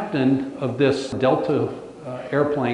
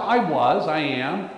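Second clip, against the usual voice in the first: ordinary delivery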